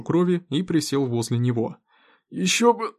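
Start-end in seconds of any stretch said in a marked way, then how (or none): 2.3-2.9 words broken by a wet cough; coughing mid-word. Acted. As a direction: choke on a cough mid-phrase. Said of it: "кашляющий Смоук". (речь, прерванная кашлем)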